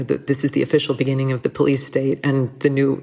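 ordinary talk

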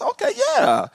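high-pitched self-satisfied okay; mock puffed-up agreement; feeling himself. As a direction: play up being pleased with yourself. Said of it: "feeling myself a little bit".

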